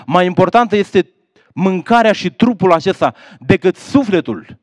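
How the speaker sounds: insistent, rising rhetorical questions; fervent preaching. Acted. Preacher confronting listeners about neglecting God, fervent. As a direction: press each question on the congregation.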